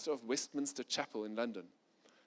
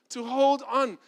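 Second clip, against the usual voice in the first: much higher and louder, heated self-justifying pleading; indignant protest; 9 semitones higher, 8 dB louder than usual. Retold or imitated. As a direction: insist on your grievance, rising to a near shout. Pastor protests his right to resentment.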